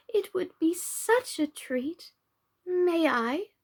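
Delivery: cooing like a pigeon. A narrator's reading. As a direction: coo it gently like a dove. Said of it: "cooing of a pigeon".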